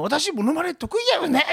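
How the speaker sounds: high, pushy boast; 迫るように. Retold or imitated. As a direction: press the husband to agree. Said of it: "ってご主人に迫っとったもん". (夫に迫るような高い声)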